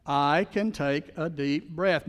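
very slow, each word drawn out; slowly, for the congregation to repeat; slow affirmation. Shown as a demonstration. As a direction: lead the room through it slowly. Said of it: "Say it with me".

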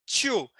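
Two with a Rio-style aspirated T, like "tchu"; carioca T demo. Shown as a demonstration. Teacher demonstrating a carioca T on Two. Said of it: "Pronto, é assim".

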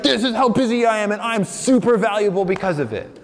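preacher imitates students bragging how busy they are, mock smug; mock boast. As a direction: ham up a smug student showing off. Mocking bragging about busyness.

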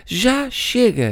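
high, slow fed-up yell; a brother who has had enough; shouting. Acted. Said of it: "gritou o Monty". (grito farto, agudo e lento)

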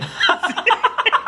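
high burst of laughter. Real, not acted. Laugh at haircut joke.

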